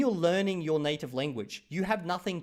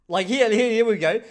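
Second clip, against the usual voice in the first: eager start; 8 semitones higher, 11 dB louder than usual. Real, not acted. Reads chat comment, excited.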